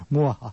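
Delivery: evil vampire cackle; sneering panto villain. Pretend. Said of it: "I'm still in Halloween mode".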